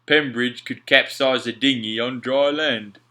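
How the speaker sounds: mock the captain; Alf, mocking sailor. A narrator's reading. Alf joking to Mac about Pembridge, slow scoffing voice.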